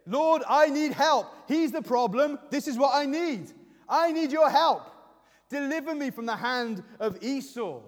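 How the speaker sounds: preacher voicing a desperate prayer; urgent plea to God. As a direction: plead loudly with God for rescue. Acts out Jacob pleading for help.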